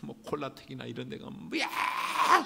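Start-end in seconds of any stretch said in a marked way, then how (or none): 1.6-2.4 high-pitched mock whoop; imitated excited yell. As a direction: mimic revelers screaming at a dance hall. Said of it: "소리를 질렀는데 막 큰 소리를". (신나서 지르는 고함 흉내)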